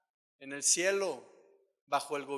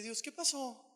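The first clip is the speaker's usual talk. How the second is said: higher, bewildered plea to God; pleading; 7 semitones higher than usual. Retold or imitated. Preacher asks God why the anointing faded, bewildered. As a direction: ask God what went wrong.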